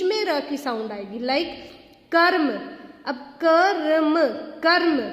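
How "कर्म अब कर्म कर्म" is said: कर्म with a short clipped र before म, said loudly. Shown as a demonstration. Teacher drilling the short र: कर्म, louder. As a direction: repeat कर्म, keeping the r short. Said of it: "र की short sound".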